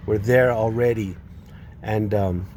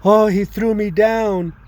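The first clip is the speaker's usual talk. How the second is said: high, loud, slow mock cry of accusation; retold yell; 8 semitones higher, 4 dB louder than usual. Bernadette accusing him after falling, a high slow mock yell. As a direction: wail it out as if hurt. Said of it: "she yelled".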